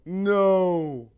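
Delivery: sharp high loud no; loud refusal. Retold or imitated. Mom refuses her son at the door, loud and high. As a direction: bark a startled no. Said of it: "She was like".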